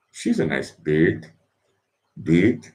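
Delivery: tentative try at British vowels; attempted British accent. Shown as a demonstration. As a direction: reach for a British "bird" with no r, not quite getting it. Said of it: "I can't do the British accent".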